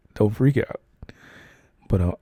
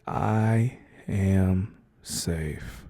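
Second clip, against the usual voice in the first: slow affirmation; much slower than usual. Shown as a demonstration. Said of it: "I want you to repeat after me".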